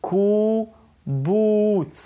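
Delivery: "קובוץ" split into two long oo syllables; drawn-out "קובוץ" for learners to hear; sound demonstration. Shown as a demonstration. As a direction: say "קובוץ" very slowly, holding each oo. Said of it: "אבל פה נשמע אותו קודם".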